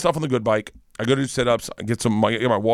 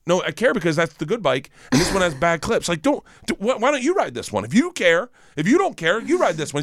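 heated arguing; 7 semitones higher than usual. Retold or imitated. Escalating bike argument, rising pitch.

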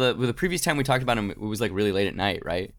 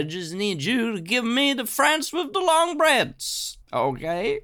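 much higher, pleading voice; mock plea; 11 semitones higher, slower than usual. Masaryk begging Wilson for bread, much higher. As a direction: beg for it in a squeaky high voice.

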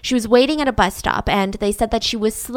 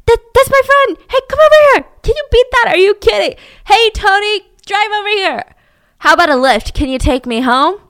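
high, loud, showy greeting; [name] staging a chance meeting, loud and high; fake-surprised hailing; 10 semitones higher, 9 dB louder than usual. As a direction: act like a lucky coincidence.

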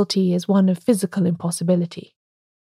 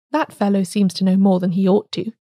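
ordinary delivery

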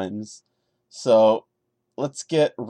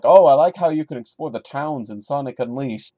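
louder, higher sing-song mockery of the line; mocking the author; 3 semitones higher, 6 dB louder than usual. Mocking the story's author for his random brag, sarcastic. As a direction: poke fun at the bragging, hammed-up. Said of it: "it just seems a little self-congratulatory".

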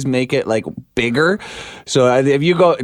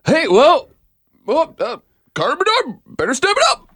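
much higher, pinched sportscaster voice; Devin Peacock impression; 12 semitones higher than usual. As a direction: do a high, exaggerated imitation. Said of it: "That's my Devin Peacock impression".

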